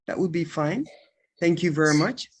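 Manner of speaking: normal talking voice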